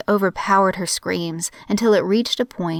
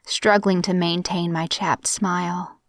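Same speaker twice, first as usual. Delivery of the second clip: ordinary delivery, nothing marked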